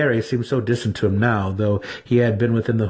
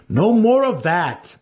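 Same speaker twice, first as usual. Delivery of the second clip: much higher, louder, slow sharp rebuke; scolding; 10 semitones higher, 4 dB louder, slower than usual. A narrator's reading. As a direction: cut him off with a stern slow scold. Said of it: "Massage scolded".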